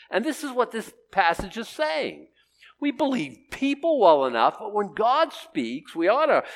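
high-pitched, forceful preaching; preacher in full flow, pitched high; impassioned preaching. Real, not acted.

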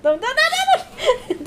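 squealing high through laughter; laughing squeals, pleading; being tickled. Real, not acted. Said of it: "Don't tickle me".

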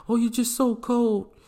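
high, slow mocking whine; lightly mocking girlfriend voice; mock whiny woman. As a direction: mimic her complaint with a sneer.

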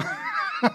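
a quick breathy laugh of delight; short airy laugh. Real, not acted. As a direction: laugh out at the surprise. Laughs at the sudden rescue.

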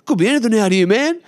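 high, loud mock panic; hammed-up panicky high voice; freaking out. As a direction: imitate a mate losing his nerve. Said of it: "starting to freak out proper".

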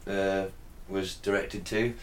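low, quiet pseudo-expert explanation; mock technical. Podcaster floats silly spaceship theory about the base, mock technical. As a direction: sound technical, softly and hesitantly. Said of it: "sounding very technical here".

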